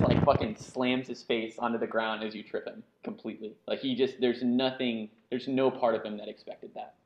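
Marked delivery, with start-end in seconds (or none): none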